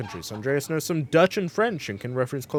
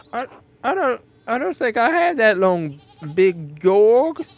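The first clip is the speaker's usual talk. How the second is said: higher, hammed-up foreign accent for Andreas; awful put-on accent; 9 semitones higher, 7 dB louder than usual. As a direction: play Andreas with a deliberately bad accent. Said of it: "my awful accent".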